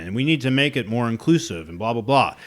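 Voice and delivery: normal talking voice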